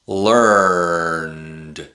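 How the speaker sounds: ordinary delivery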